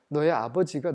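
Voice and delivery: ordinary talk, nothing marked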